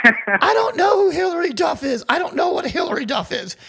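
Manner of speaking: high, loud mock shout from a drunk buddy; retold shout. Retelling how his drunk friend shouted back, high loud mock yell. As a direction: belt it out like a hammed-up drunk yell.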